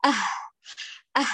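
أه breathed out as empty air; faked airy ha. Shown as a demonstration. Teacher imitating a reader's breathy ha. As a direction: sigh أه with no real voice. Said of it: "يقول".

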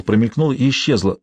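ordinary delivery

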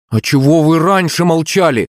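high, accusing question; indignant boy. A narrator's reading. Son confronting parents who kept silent, indignant. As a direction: push the pitch right up in childish indignation. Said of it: "возмущённо засопел их сын".

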